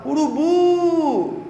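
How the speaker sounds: "urubu" called out high with a long-held final BU; calling the word. Shown as a demonstration. Teacher showing how people call the word, "urubu" drawn out. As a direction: hail "urubu" as if it were someone far away. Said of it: "Tem gente que chama a palavra".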